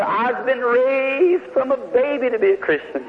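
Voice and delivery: high, slow, earnest woman's voice; mother's voice. Retold or imitated. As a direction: lift into the mother's proud reply, slowly. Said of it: "She said".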